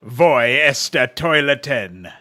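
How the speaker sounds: loud, slow, drawn-out caricature German; mock German. Shown as a demonstration. Streamer showing the one question he knows, loud mock German. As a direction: belt it out slowly in an exaggerated German accent. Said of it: "The most I can do German".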